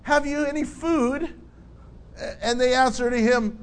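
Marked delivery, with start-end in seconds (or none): 0.0-1.3 calling out. Acted. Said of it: "So when he says".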